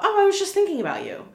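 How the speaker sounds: high, bright mock surprise at the phone call; surprised. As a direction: play it as if mom just rang out of the blue.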